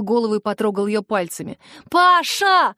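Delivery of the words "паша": high, loud, drawn-out shout of a name; frantic cry. A narrator's reading. Sister watching her brother fight, frantic cry. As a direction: cry out, beside yourself. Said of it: "вскрикнула я, не помня себя".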